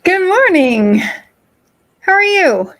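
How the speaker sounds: high, loud cheerful hello; bright greeting. Real, not acted. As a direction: greet her with big energy.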